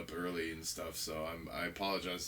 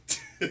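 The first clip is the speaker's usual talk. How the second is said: burst of laughter; 3 dB louder than usual. Real, not acted. Teasing about saying it.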